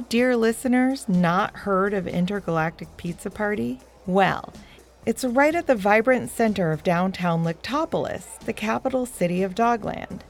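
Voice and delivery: usual speaking voice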